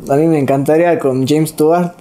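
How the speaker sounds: ordinary talk, nothing marked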